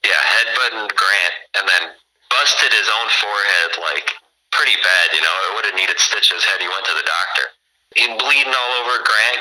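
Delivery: ordinary delivery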